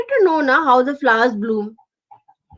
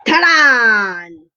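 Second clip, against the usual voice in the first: long falling crash noise; crash sound effect; 3 semitones lower, 4 dB louder than usual. Acted. Glass of juice hitting the floor, imitated crash. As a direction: draw out the crash, pitch sliding down.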